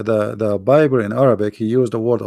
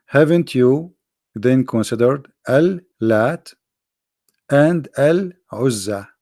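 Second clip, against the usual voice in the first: slow scripture reading; slower than usual. Shown as a demonstration. Reading the verse out slowly.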